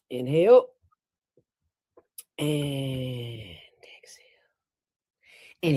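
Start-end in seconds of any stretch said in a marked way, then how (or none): 0.1-4.3 an audible inhale, then a whispered exhale; breathing demonstration, whispered exhale; audible breath demo. Shown as a demonstration. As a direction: breathe in and out so it is heard. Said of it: "You simply go".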